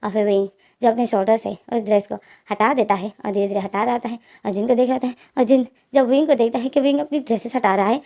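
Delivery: ordinary delivery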